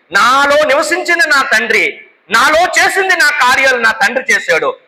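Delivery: high, proclaiming quotation; impassioned preaching. Acted. Preacher voicing Jesus to departing disciples, high fervent proclamation.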